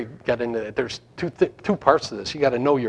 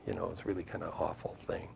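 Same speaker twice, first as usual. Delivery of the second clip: fast, softer whispered aside; 9 semitones lower, 17 dB quieter, faster than usual. Real, not acted. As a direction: drop to a quick hushed whisper. Whispered aside about the scandal.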